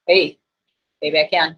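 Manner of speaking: usual speaking voice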